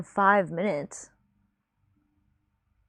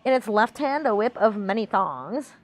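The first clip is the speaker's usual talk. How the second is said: singsong dumb blonde voice, pitch swooping. Pretend. Reader doing redeemed voice for stream, dumb blonde. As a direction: read it like a ditzy blonde. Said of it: "supposed to be reading a Dumb Blonde voice".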